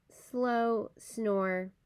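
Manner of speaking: ordinary delivery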